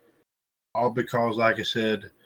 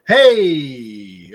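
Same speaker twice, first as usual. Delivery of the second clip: loud hey; 10 dB louder than usual. Real, not acted. Laughing at his own joke.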